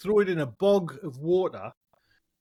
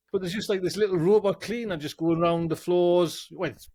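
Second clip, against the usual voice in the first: ordinary talk, nothing marked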